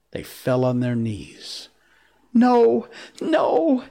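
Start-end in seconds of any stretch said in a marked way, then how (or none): 2.3-3.8 very high, pleading wail; piteous cry. A narrator's reading. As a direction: cry out in helpless terror. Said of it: "they cried so piteously".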